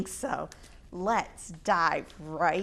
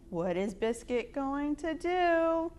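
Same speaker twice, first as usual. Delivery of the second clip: ordinary delivery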